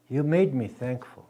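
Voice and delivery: ordinary delivery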